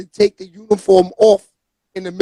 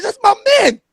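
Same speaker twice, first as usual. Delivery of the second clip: excited; 10 semitones higher than usual. Retold or imitated. Retold excited reaction to Rasul.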